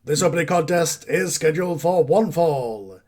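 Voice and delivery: sing-song match introduction; ring announcer. Acted. Announcer introducing the opening contest, formal ring announcement. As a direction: announce the bout to the arena.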